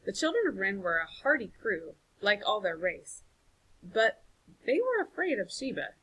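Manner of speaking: ordinary delivery, nothing marked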